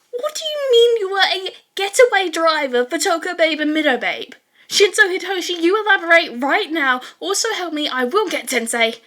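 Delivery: high, loud demanding shout; outraged yell. Acted. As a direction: call him out across the room. Classmate demands answers, outraged.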